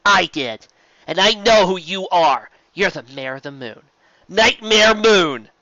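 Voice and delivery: name her with bold defiance; defiant declaration. A narrator's reading. Twilight confronts Nightmare Moon, loud defiant cry.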